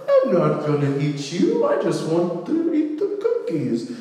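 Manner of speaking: wolf playing innocent granny, rising high; slippery mock-innocent falsetto; fake innocent. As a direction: plead innocence in a put-on granny voice. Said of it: "probably acts innocent".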